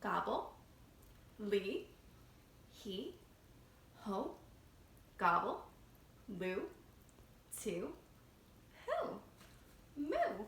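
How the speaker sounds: put-on each syllable slowly, drawn out for kids to echo; repeat after me. Shown as a demonstration. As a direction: model the sounds for class to repeat. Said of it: "repeat after me".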